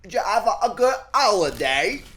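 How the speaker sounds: high, loud posh British voice; exaggerated high British accent; mock British accent. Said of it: "That sounds British, right?".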